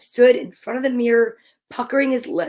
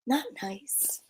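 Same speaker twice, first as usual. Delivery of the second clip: whisper; 14 dB quieter than usual. Real, not acted. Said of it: "reader's whispered aside".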